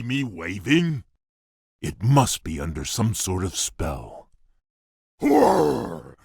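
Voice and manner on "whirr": mock whirring noise. Imitating a machine noise.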